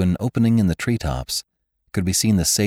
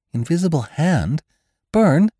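much higher, louder startled cry; shocked disbelief; 12 semitones higher, 4 dB louder than usual. A narrator's reading. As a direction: gasp the words out in shock. Jane shocked by accusation.

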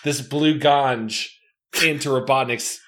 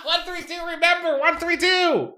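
high screamed echo. Acted. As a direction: squeal it out at the top of the range. Player echoes the screamed call.

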